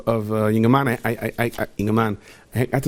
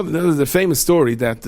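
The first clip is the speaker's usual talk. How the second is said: usual speaking voice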